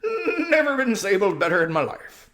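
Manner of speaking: high, loud hearty horse voice; jolly horse. Acted. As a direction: boast like a proud old warhorse. Bree the horse speaks.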